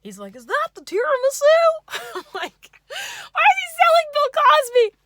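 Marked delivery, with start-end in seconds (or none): 0.3-1.4 far higher, louder and fast goofy squeal; yay voice. Retold or imitated. Date squeals at the tiramisu in a goofy voice. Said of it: "Bill Cosby meets, like, yay voice". 1.5-2.1 high shrieking laugh. Real, not acted. Laughing at own impression. 2.2-5.0 laughing. Real, not acted. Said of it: "I'm sorry".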